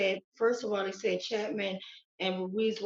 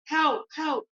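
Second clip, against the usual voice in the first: higher-pitched imitated plea for help; retold scream for help; 6 semitones higher, 4 dB louder than usual. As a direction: re-enact the cries, not full-out. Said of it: "He's screaming for help".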